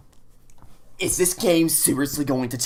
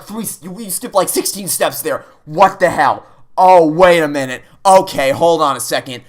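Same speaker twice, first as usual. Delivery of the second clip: ordinary delivery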